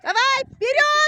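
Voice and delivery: very high, loud cheering. Real, not acted. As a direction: yell encouragement at a racer. Mother cheering daughter in ski race.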